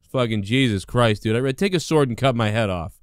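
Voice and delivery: ordinary talk, nothing marked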